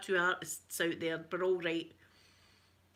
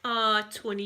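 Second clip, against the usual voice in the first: ordinary delivery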